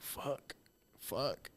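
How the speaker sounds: hushed panic under the breath; whispered fear. Retold or imitated. Scared watching the drop.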